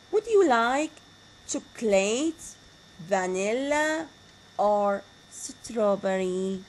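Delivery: teacher demonstrating alternative question tune; rising list intonation. Shown as a demonstration. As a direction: rise on "chocolate" and "vanilla", fall on "strawberry". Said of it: "there is a rising tone on each one".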